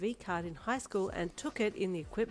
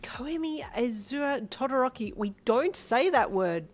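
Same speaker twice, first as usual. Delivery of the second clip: raised, drawn-out telling-off; scolding; 6 semitones higher, 6 dB louder than usual. A narrator's reading. Mother using child's full name, scolding. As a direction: scold firmly, louder and slower.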